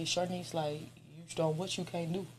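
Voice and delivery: soft, slow, lightly voiced father; father's voice. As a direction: gently tell her she's strong, slowly. Father's words retold softly.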